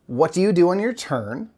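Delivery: usual speaking voice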